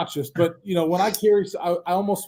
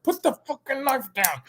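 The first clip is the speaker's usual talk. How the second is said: imitating the wife; 9 semitones higher, 3 dB quieter than usual. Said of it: "She's like".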